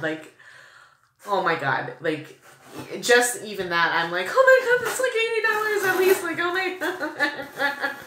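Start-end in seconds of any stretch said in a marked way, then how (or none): 4.3-7.9 very high, loud, laughing gush; excited squeal. Real, not acted. Vlogger amazed at an expensive gift, excited laughing. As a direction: squeal in disbelief, breaking into laughter.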